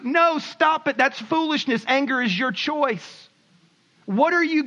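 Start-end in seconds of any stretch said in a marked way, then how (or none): none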